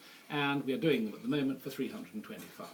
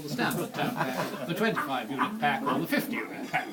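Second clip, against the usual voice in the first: laughing; 5 dB louder than usual. Real, not acted. Teller cracking up mid-story.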